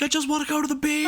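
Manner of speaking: very high, fast whiny voice; squeaky whine. Retold or imitated. The fleeing man whining to the cops. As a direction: squeak it like a cartoon. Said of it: "He's like".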